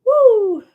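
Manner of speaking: loud high whoop. Real, not acted. Sudden cheer after pain talk.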